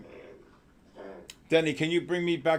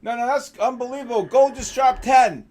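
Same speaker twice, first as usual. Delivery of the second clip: real shout of surprise at the market; excited shout; 8 semitones higher, 7 dB louder than usual. Real, not acted. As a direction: bellow the news of the drop. Shouting as gold drops live.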